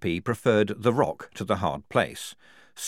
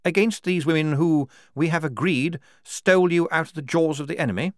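high, insistent officer's voice; challenging major; 10 semitones higher, 5 dB louder than usual. Acted. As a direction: press the point with a superior.

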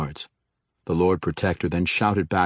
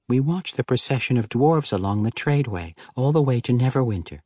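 higher woman's voice, explaining; 5 semitones higher than usual. Acted. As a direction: explain it as the wife. Denala explaining.

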